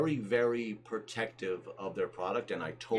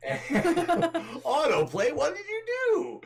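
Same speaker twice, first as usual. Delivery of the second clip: very high, laughing alarm; amused dismay; 5 dB louder, much slower than usual. Real, not acted. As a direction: squeal it in flustered, laughing dismay. Wrong video starts playing on stream.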